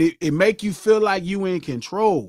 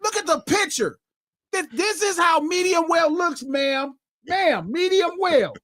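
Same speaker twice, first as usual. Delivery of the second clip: imitated restaurant staff; 7 semitones higher than usual. Acting out restaurant staff showing picture.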